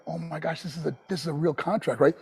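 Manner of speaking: retold hushed disbelief; hushed awe. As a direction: say it quietly, as if to yourself. Awed reaction to the contract.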